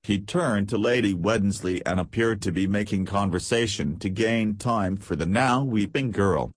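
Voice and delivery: plain reading voice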